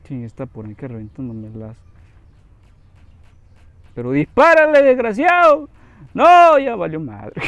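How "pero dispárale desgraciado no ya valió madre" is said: loud, high furious shouting; angry yelling. Real, not acted. Gamer yelling at his ally to shoot, angry. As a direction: yell at the useless ally.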